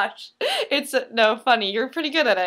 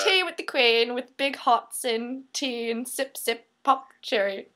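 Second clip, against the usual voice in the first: slow, badly faked British accent; American stringing out mock British; a hammy attempt at British. Shown as a demonstration. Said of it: "let me hear your best British".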